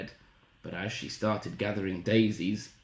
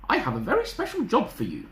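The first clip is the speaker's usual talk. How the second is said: higher-pitched mother with a job to give; a hint of a storybook mum, higher; lightly voiced mother; 11 semitones higher than usual. Mother giving Little Red a job, lightly voiced.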